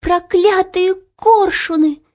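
high, drawn-out curse; defiant cry. A narrator's reading. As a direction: cry out in girlish outrage. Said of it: "закричала Ело".

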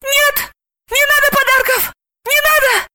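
very high panicked squeal; Самохина's piercing squeal. A narrator's reading. Panicking president backs away from Santa's gifts, squealing very high. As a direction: squeal as if backing away in terror. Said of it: "визжал пронзительным визгом Самохиной".

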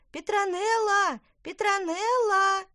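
high, loud, drawn-out calling; yelling at full strength. A narrator's reading. Twins call the witch from the garden, full-strength yell. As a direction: holler the name for help. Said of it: "Близнецы завопили, что есть сил".

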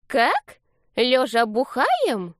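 high, drawn-out disbelief; old lady aghast, slow and high; shocked granny. A narrator's reading. Hard-of-hearing grandma mishears her grandson, high shocked question. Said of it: "округлились её глаза".

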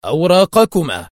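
loud, rough demand; high, forceful, gruff order. A narrator's reading. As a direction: demand papers like a checkpoint soldier. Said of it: "وقال أحدهم في خشونة".

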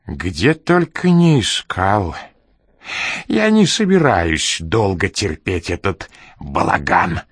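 put-on slow, grumbling old man voice; a hint of a cranky old man; grumpy old man. A narrator's reading.

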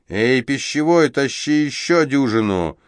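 calling out. Acted. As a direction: hail the waiter, drawing out пищевой. Thug bossing the waiter.

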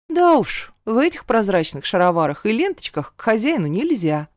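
ordinary delivery

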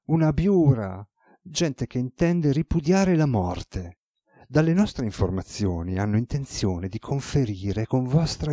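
a dutiful aide delivering grave news; deferential secretary. Acted. Secretary reports to god.

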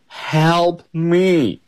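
high, drawn-out plea for help; begging lion. A narrator's reading. Teacher voices the lion caught in a net, begging in a high voice. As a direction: plead as the trapped storybook lion. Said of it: "begs Lion".

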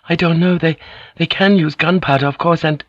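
high, anxious and halting; unsure and scared, trailing off. Acted. Mariko answers Blackthorn, high and anxious.

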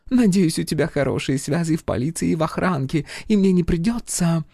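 high-pitched French lady voice; femme fatale. Acted. Narrator voices French woman.

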